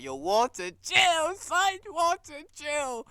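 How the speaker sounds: high, loud call to chill; mock yell. Viewer yelling at Walter on screen, high and loud. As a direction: yell at him to calm down.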